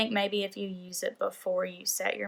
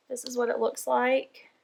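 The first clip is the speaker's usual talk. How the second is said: normal talking voice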